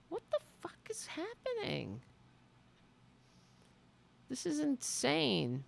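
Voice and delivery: high, hushed astonishment; stunned disbelief. Real, not acted. Streamer overwhelmed by donations, hushed high disbelief. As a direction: breathe it out in shock.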